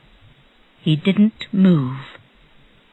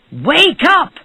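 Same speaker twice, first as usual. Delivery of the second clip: high, loud, sharp order; angry shout; 10 semitones higher, 5 dB louder than usual. A narrator's reading. Rich man finds someone at his door, shouting angrily. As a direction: shout to rouse a sleeper. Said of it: "he shouted angrily".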